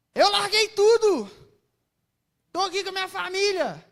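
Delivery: high, loud, exasperated protest to God; preacher reliving her protest to God, indignant. Retold or imitated. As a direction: argue back, hurt and incredulous. Retold argument with God.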